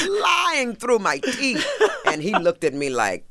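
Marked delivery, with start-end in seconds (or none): 0.0-1.7 slow laugh through the words, pitched up high. Real, not acted. To the host, laughing slowly about her own lie. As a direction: chuckle it out slowly at your own fib.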